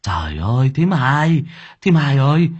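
high, loud panicked shout for help; loud frantic call. Acted. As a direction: yell for the woman to hurry. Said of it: "đã kêu to".

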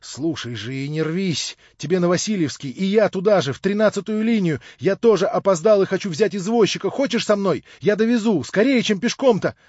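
high, loud, insistent urging; urgent persuasion. A narrator's reading. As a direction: talk over someone's panic, pressing hard. Urgently persuading a panicking girl.

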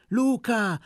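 high, loud cheer; the village women cheer Luca, high and loud. A narrator's reading. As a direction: cheer him on from the crowd.

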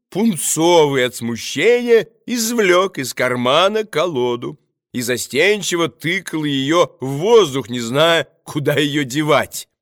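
ordinary delivery, nothing marked

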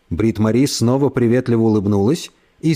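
ordinary delivery, nothing marked

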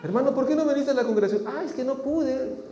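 mock church dialogue. Brother questioned on absence.

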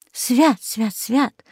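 quick muttered prayer, fading; cross yourself and gabble it; babbling. A narrator's reading. Old woman startled by the talking parrot, babbling. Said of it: "залопотала".

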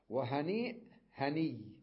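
وهنيء with the hamza, then هني ending on a doubled y; slow demo of the softened form; هني without the hamza; hamza then dropped. Shown as a demonstration. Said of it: "يعني ألفظها هكذا".

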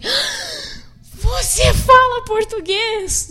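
very high, slow, drawn-out você fala português?; amazed disbelief. Retold or imitated. Missionary stunned a stranger speaks Portuguese, very high and slow. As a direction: stretch it out in delighted shock.

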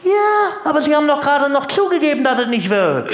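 eager gotcha. Acted. Caller pounces on admission.

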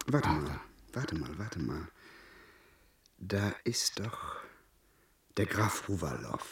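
whispered scheming discovery; hushed realisation. Acted. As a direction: whisper as the plan dawns. Sudden idea, hushed.

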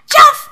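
very high, loud splash noise; splash sound. Acted. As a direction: shout the splash. Boy falls into pot.

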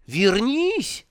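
calling high after someone falling away; yelling after him. Acted. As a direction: shout come back, high. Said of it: "завопил демон". (крик вдогонку, высоко)

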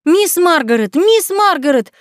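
calling out loud and high, out of breath; breathless shout. A narrator's reading. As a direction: shout her name across the yard, breathless. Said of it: "кричала запыхавшаяся Люси".

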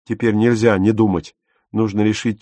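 ordinary delivery

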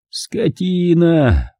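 медленный высокий стон; протяни ругательство, постанывая на высокой ноте; стонущая брань. A narrator's reading. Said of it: "простонал он". (высокий протяжный стон)

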